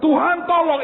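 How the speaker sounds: mock plea from a stubborn woman; pleading woman. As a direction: beg the Lord, insistent. Woman begging insistently.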